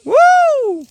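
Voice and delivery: high, loud drawn-out "woo"; long ringing holler; whoop. Real, not acted. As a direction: let out a big high whoop. Calling out over the view.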